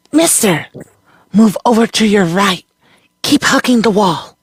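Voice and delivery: put-on high, sharp young girl calling; girl's voice. A narrator's reading. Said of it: "A girl's voice coming from nowhere".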